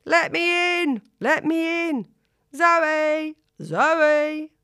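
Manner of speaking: loud, high yell at the door. A narrator's reading. Drunk guest at the door yelling for Zoe, loud and high. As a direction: bang on the door and shout to be let in. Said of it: "someone yelling".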